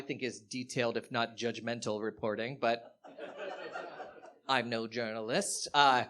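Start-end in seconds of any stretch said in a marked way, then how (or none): none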